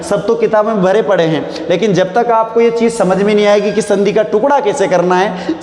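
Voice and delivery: ordinary delivery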